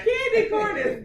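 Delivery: laughing candy corn is good, loud and high; laughing gloat. Real, not acted. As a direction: savour the win while laughing. Gloating agreement.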